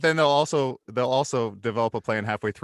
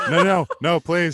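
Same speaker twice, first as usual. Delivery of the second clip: laughing mock plea; 6 semitones higher, 8 dB louder than usual. Begging in jest, laughing.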